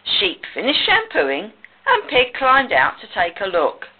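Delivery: ordinary delivery, nothing marked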